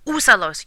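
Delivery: "Úsalos" stressed on the first syllable ú; Spanish word said clearly. Shown as a demonstration. Teacher modelling "Úsalos" for the class, ú stressed. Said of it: "use them".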